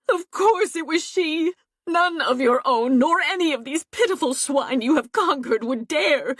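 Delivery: a hint of tearful, high complaint; sobbing. A narrator's reading. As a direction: plead bitterly with rising pitch. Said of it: "Fawn gasped with sobs".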